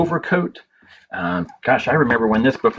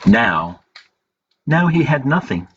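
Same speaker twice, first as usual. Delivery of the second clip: story narration; 3 semitones lower than usual. A narrator's reading. Librarian reading a picture book to children, gentle storytelling.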